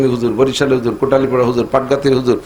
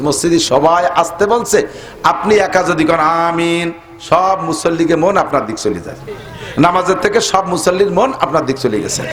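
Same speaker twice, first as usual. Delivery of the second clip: normal talking voice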